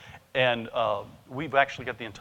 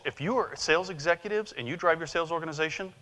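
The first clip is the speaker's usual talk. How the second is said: ordinary talk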